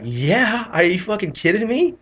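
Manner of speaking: high, loud, incredulous yes; excited. Retold or imitated. Airman assuring the captain he wants to see UFOs, excited. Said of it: "excited to see something in my life".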